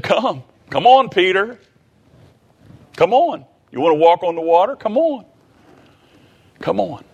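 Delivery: high, lightly acted coaxing; imitated encouraging invitation; beckoning call. Voices Jesus urging Peter out.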